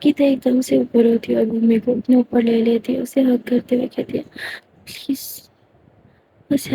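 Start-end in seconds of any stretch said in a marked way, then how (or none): 4.8-6.7 a faint voiceless please; whispered plea. Acted. Geeta hugging Bhumi, whispering a soft plea. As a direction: beg gently, barely audible.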